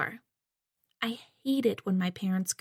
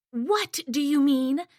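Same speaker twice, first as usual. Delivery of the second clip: a higher, slower bark from the mother; mild mock bark, raised pitch; 6 semitones higher, 3 dB louder, slower than usual. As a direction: snap it out as the impatient mother. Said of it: "my mother barks".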